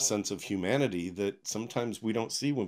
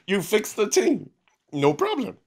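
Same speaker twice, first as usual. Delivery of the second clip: laughing delight; 12 semitones higher, 7 dB louder than usual. Retold or imitated. Repeating the Jamaican waiter's words, delighted and laughing. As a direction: quote repeated much higher and louder through laughter. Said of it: "and he said".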